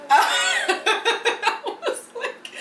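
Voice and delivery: high squeal of laughter; laughing. Real, not acted. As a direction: laugh through the words. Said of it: "laughs mid quote".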